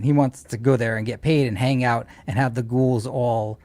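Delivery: usual speaking voice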